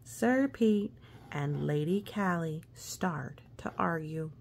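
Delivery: ordinary delivery, nothing marked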